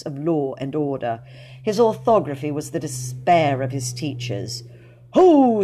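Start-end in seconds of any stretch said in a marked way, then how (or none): 5.1-5.6 loud, high pirate shout; hearty ho. A narrator's reading. Dick Savage calls to his smugglers on the beach, loud. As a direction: bellow it like a smuggler chief.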